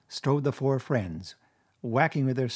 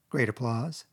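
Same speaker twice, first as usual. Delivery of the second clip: ordinary delivery, nothing marked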